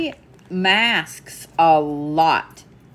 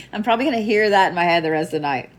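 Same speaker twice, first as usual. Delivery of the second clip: normal talking voice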